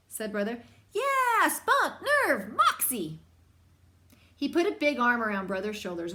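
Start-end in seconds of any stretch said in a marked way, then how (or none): 0.9-3.2 emphatic. Acted. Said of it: "You've got moxie".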